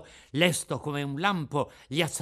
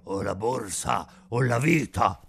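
slow, hollow, menacing voice; cavernous voice; 3 semitones lower, 3 dB louder than usual. Acted. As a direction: growl it like a bandit in the dark. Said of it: "due voci orribili e cavernose". (voce cavernosa e orribile)

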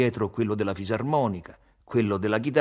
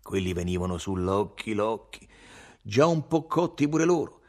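ordinary delivery, nothing marked

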